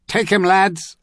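loud, high command shout; rally the men with a yell; shouted order. A narrator's reading. Young officer ordering his men to attack, loud high shout. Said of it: "another voice shouted".

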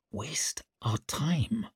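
slow, soft disbelief; hushed, measured echo; said quietly. A narrator's reading. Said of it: "said Leo".

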